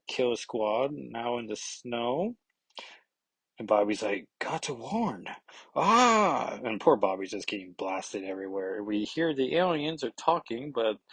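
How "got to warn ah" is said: hammed-up comic yelp; mock cry of pain. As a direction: cut off mid-word by a blast. Bobby blasted mid-warning.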